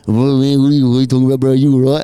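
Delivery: put-on loud, slurring drunk bouncing back a question; drunk guy's loud reply. Drunk guy loudly brushing off the narrator at the door. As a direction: play the big drunk dude, loud and dismissive. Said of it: "looking down at me going".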